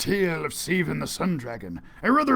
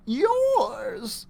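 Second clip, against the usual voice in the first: put-on higher old woman's voice; old lady; 12 semitones higher than usual. Old lady gives the treasure away, higher voice. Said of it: "she said".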